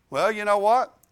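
normal talking voice

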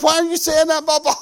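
put-on little girl's voice. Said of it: "daughter asks".

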